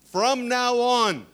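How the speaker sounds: loud, slow proclamation; thundering pulpit emphasis, word by word; preached emphasis. Acted. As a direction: declare it loud and drawn out. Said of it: "say, "From now on".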